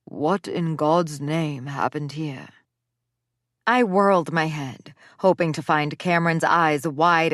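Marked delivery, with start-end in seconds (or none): 0.0-2.5 put-on slow drawling Southern accent. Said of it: "a deep voice that drawled words in an accent".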